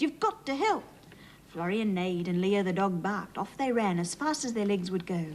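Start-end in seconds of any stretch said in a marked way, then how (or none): 0.0-0.8 urgent shout. A narrator's reading. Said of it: "he shouted".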